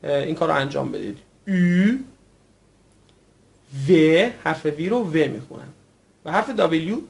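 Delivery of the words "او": "او" stretched long, lips pursed, between ee and oo; drawn-out rounded vowel. Shown as a demonstration. Teacher shows learners the easy way, "او" drawn out. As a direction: hold "او" with rounded lips, rising. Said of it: "اگه به صورت کشیده تلفظ کنید".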